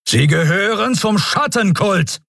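high-pitched, forceful accusation; heated interrogator's accusation; accusing. A narrator's reading. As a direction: fling the charge at the prisoner. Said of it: "richtete er seinen Zeigefinger anklagend".

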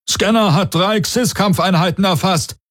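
hohe, drängende Stimme eines Offiziers; Meldung eines Besatzungsmitglieds. A narrator's reading. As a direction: melde mit deutlich erhöhter Stimme. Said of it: "meldete einer von Darayos Leuten". (dringende Meldung)

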